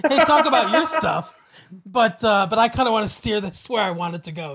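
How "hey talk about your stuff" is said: bright, laughing mock politeness; mock courteous invitation. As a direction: play the polite host with a laugh. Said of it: "I was gonna do the courteous thing and just say".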